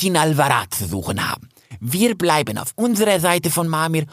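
high, proud stag voice, apologetic; self-important young stag explaining himself; young stag, cowed and formal. A narrator's reading.

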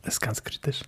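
hushed, secretive whisper; whispered aside. Real, not acted. Conspiratorial remark about virus.

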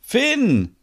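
high, drawn call of a name; high-pitched joyful call. Acted. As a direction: call back to your sweetheart. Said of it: "Stina ruft".

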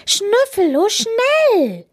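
high urgent yell. A narrator's reading. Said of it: "schreit Linus".

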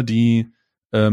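usual speaking voice

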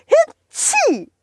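squeaky sneeze then a little laugh; high sneeze and giggle. Acted. (hohes Niesen, dann Kichern)

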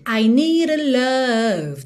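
slow "nee-ra love", "love" held long; tapped D. Shown as a demonstration. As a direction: tap "need a", stretch "love". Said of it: "tem esse rã no final de need".

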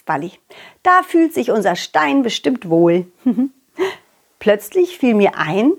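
ordinary delivery

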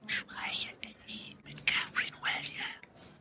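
slow, toneless whisper; creepy anonymous caller whispering down the line. A narrator's reading. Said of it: "flüsterte eine Stimme am anderen Ende". (langsames, unheimliches Flüstern)

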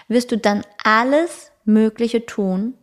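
slow, with tun clearly weighted; stress on tun. Shown as a demonstration. Teacher to her listener, slow and leaning hard on tun. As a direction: lean on the word tun, slowly. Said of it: "Die Betonung liegt auf dem Wort tun".

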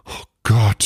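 mild groan of dread; groaned dismay. A narrator's reading. Bruno seeing his friend pet the bear, groaned dismay. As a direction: groan it out, aghast. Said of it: "stöhnte Bruno".

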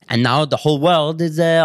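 ordinary delivery

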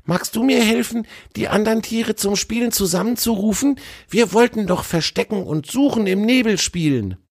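an excited little squirrel asking a favour; high, quick critter voice; eager squirrel. A narrator's reading. Squirrel asks elk for help.

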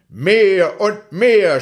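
lautes, langsames, gedehntes Kinderrufen; gierig schreiend. Acted. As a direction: ruf es gierig und gedehnt heraus. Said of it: "schrie er". (Häwelmann ruft dem Mond gierig laut zu)

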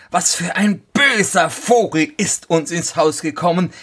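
high-pitched wife's voice; dismayed wife. A narrator's reading. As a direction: complain to the husband, pitched high. Wife reports the birds' invasion. (hohe, klagende Frauenstimme)

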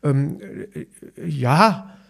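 hesitant, imitated yes; retold halting agreement; stammering. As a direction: stall with fillers before giving in. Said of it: "stammelte ich das zweite Mal rum".

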